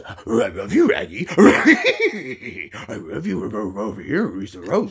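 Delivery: slow rough dog-talk; lightly voiced Scooby-Doo; Scooby-Doo impression. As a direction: speak like a cartoon dog with r's. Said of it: "Scooby-Doo is".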